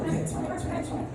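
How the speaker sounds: whispered, cowed reassurance; mock meek whisper. Comic reassuring driver in a meek whisper. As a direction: shrink and whisper it away. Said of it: "I'm like".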